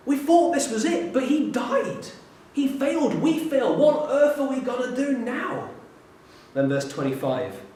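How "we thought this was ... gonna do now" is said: higher, louder despairing lament; crushed by failure. Acted. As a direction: pour out their dashed hopes. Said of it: "this crushing weight of failure".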